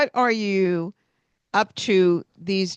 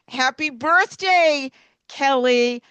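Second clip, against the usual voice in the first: much higher, slow, sing-song hello; cheerful greeting; 6 semitones higher, 4 dB louder, slower than usual. Retold or imitated. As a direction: greet each kid like it's the best part of your day. Cross guard greeting a child.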